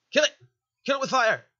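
high, loud battle cry; frantic, hammed-up attack order; mock-panicked yell. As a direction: shout it in exaggerated horror. Mock panic at game enemy.

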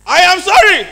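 shouted high 'I am sorry'; loud, insincere tone. Shown as a demonstration. Speaker showing an audience two meanings of one sentence, shouted. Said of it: "than how you say it".

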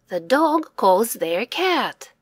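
ordinary delivery, nothing marked

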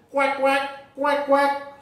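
high voiced duck quacking; duck quacks. Ducks crossing the road, quacking high.

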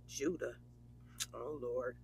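stunned hush. Acted. Friend hearing the ex was back, stunned and hushed. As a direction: let the shock drop the voice. Shocked by ex's name.

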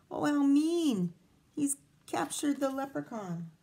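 ordinary delivery, nothing marked

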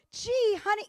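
high, bright-pitched helpful reply; nice tone. Shown as a demonstration. Wife demonstrating reply to casual husband, nice tone. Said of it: "in a nice tone".

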